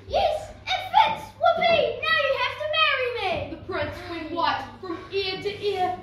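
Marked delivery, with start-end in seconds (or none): 0.1-3.3 high, loud, gleeful scream. A narrator's reading. Ugly sister claiming the prince, triumphant scream. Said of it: "But she screamed".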